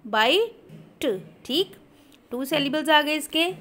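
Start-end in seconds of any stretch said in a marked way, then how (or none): 0.1-1.3 drawn out slowly, syllable by syllable; slow syllable split. Shown as a demonstration. Teacher dragging bite out slowly to show its syllables. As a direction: stretch the word bite slowly into its two syllables. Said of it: "यहाँ पे bite, टू syllables हैं".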